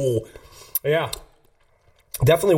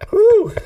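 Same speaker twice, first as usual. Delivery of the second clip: hot exhale; 6 dB louder than usual. Real, not acted. Reacting to hot sauce burn.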